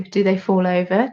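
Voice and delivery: ordinary delivery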